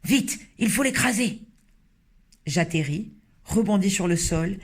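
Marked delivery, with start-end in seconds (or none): 0.0-1.4 urgent shout. Acted. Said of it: "criait-il".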